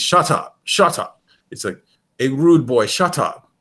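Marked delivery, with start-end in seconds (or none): 0.0-1.2 high-pitched imitation of London "Shut up"; London rapper accent. Showing how the rapper repeats his hook, imitated London accent. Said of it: "he repeats". 2.2-3.5 higher, louder mimicked London hook; London rapper accent. Quoting the rapper's song to a friend, imitated London accent. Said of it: "London rapper".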